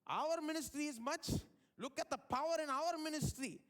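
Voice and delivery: imitated self-important boast; mock boastful. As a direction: show off like a rival preacher. Acting out boastful critics of his church.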